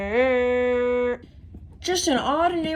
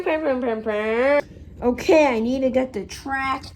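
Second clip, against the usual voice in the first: ordinary delivery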